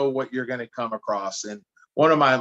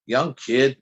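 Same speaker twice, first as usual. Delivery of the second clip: ordinary delivery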